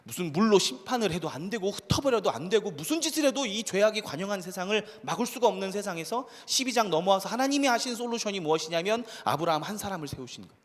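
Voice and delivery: usual speaking voice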